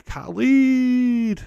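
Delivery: high, loud, long-held name; playful name call. Acted. Streamer hailing a viewer in chat, playful high call.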